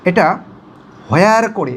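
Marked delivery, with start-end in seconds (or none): 1.1-1.4 হোয়ার with an h at the front; wrong h sound. Shown as a demonstration. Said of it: "এটা কিন্তু ভুল". (ভুল উচ্চারণ: হোয়ার)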